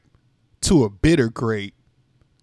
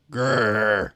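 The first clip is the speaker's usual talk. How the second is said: short grumpy growl; low cranky growl; 4 semitones lower than usual. Acted. Sour grape growls.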